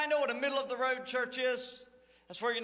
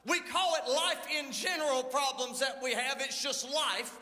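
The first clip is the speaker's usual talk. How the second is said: lively raised pulpit preaching; 4 semitones higher than usual. Acted. Pastor preaching to his church about the middle road, fervent. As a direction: preach it out to the congregation.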